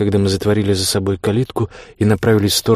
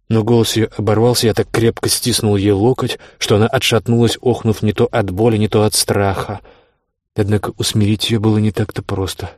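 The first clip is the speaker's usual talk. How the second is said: usual speaking voice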